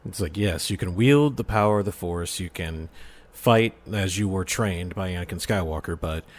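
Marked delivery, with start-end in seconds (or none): none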